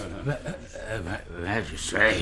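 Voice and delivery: cowed, halting stammer; stammering fear. Acted. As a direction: lose your nerve mid-word. Captain cowed by magistrate threat.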